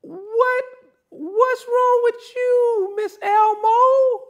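high, drawn-out hammed-up alarm; mock high shocked voice. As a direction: squeal it in mock disbelief. Mock pastor scolding woman.

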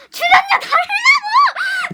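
high, slow, loud demand; mock outraged shout. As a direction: stretch it into a high mock protest yell. Escalated repeat of mock demand.

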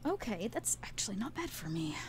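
hushed aside to the table; quick whispered aside about a good roll. Real, not acted. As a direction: whisper it so the others barely hear.

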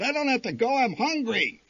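put-on high, insistent kid voice; child's whine. A narrator's reading. Little brother insisting to mother, high whine. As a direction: whine it like a stubborn little boy.

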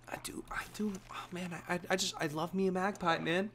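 hushed aside. Real, not acted. Quiet excited aside to chat.

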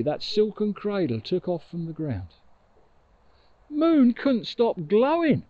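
ordinary delivery

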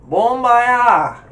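"[name]" in another accent, first syllable like "bon"; loud, high, accented BON-bah-yah; different accent. Shown as a demonstration. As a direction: switch accent on "[name]", opening the first vowel to "aw". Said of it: "you just said it with a different accent".

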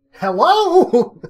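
loud, high-pitched questioning "hello"; acted calling out. Storyteller calling again into the dark hallway, high loud call. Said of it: "And again, I say".

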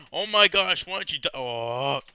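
real exasperated yell; exasperated shout. Real, not acted. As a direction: shout at the zombie. Zombie won't go down.

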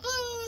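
high mock squeal of pain; pig-like squeal. Imitating a grabbed attacker at the bus stop, squealing. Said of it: "like squealing".

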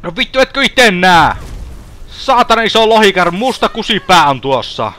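high, exasperated outburst; exasperated alarm. Pretend. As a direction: yell at people who cannot see the danger. Exasperated at others missing dragon. (korkea, tuskastunut huudahdus)

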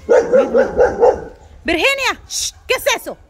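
high, loud sudden yell; yelling at the cat. Real, not acted. Owner yelling at her cat Virginia to stop, loud high yell.

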